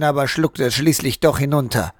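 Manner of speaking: usual speaking voice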